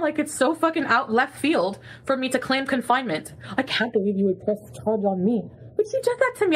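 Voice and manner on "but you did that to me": much higher, anguished accusation; hurt outburst. Real, not acted. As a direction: cry out the hurt. Accusing, voice shoots up.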